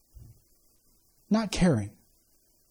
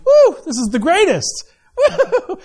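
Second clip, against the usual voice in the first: mock cheer; 7 semitones higher, slower than usual. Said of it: "adult male that got circumcised is like".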